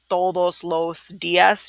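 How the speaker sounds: "todos los días" as three separate slow words; the wrong way: every word apart, slowly. Shown as a demonstration. Teacher showing the wrong way, slow separate words. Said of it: "instead of saying".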